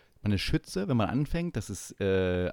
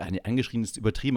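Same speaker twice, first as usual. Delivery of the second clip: normal talking voice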